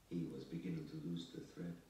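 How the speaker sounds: ordinary delivery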